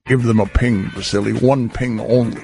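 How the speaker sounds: very low Scottish Connery voice; Sean Connery impression. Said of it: "[name]'s fake Sean Connery".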